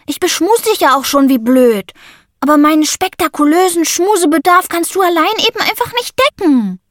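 put-on high, dramatic boy's lament; a kid wailing about missing his friends; whiny Sammy. A narrator's reading. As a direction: overplay the heartbreak. Sammy voiced by narrator.

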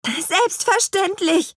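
high, drawn-out witch voice; Petronella the witch. A narrator's reading. Petronella agreeing to help the foreman, high witch voice. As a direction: answer in a high put-on voice. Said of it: "erwiderte Petronella".

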